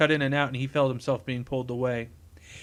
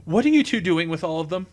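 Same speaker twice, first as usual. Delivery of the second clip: louder, higher urgent question; yelled; 4 semitones higher, 6 dB louder than usual. A narrator's reading. Engineer interrupts arguing criminals, moderate yell. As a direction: raise the voice over an argument, stopping short of screaming. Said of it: "Sisko yelled over their voices".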